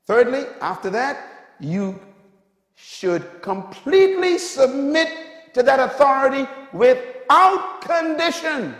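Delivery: loud, high-pitched preacher's delivery; full-force preaching. Real, not acted. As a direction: drive it home to the congregation, loud and high.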